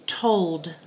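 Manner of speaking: whispering. Shown as a demonstration. Unvoiced repeat of spelling word.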